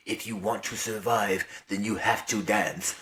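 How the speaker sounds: low, hushed, measured warning; doctor's quiet instruction. Retold or imitated. Doctor telling the girl she has to dance, quiet warning. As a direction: say it softly and low, as a stern instructor. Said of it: "Doctor Vera Gorsky literally tells her".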